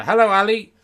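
high, very loud stage hello; ventriloquist act. Acted. Ventriloquist greets Ali onstage, high loud hello. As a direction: hail the dummy loudly. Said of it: "here's my act".

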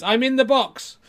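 high, loud dummy cry; ventriloquist's dummy. Acted. Ventriloquist turns round as the boxed dummy, high loud call. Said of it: "in the same voice says".

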